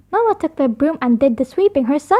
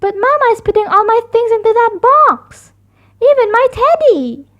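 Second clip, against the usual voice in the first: upset cub; 8 semitones higher, 3 dB louder than usual. A narrator's reading. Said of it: "said Sister".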